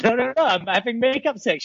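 high-pitched comic pleading; insistent protest. Retold or imitated. Husband retelling his plea to wife, high comic insistence. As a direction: protest in a high, half-laughing voice.

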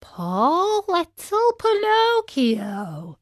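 high, very slow glowworm voice, full of pity; slow, sorrowful croon over the poor puppet. A narrator's reading. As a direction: coo it with drawn-out sympathy. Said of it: "stopping to look at him with pity".

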